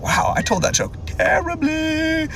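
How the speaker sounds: high, slow, drawn-out self-mockery; mock-dismayed thought in a high voice; acted inner thought. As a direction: stretch the last word out high. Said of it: "I'm thinking in my head".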